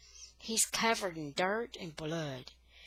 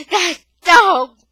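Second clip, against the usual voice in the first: high, loud panicked scream; 11 semitones higher, 21 dB louder than usual. Acted. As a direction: shriek it at the top of your lungs. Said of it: "Mama's screaming".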